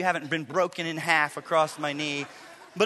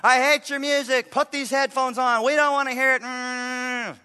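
mock yelling son, higher and louder; retold yell; 6 semitones higher, 3 dB louder, slower than usual. Son snapping at his sister over her music, mock yell. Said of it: "he starts yelling at her".

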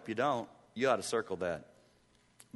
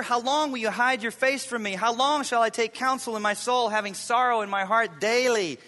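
higher, louder, pleading psalm reading; impassioned scripture reading; 7 semitones higher, 5 dB louder than usual. Acted. Pastor reading Psalm thirteen to his church, impassioned plea. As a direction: cry the psalm out to heaven.

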